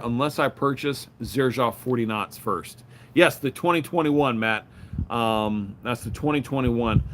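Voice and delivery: normal talking voice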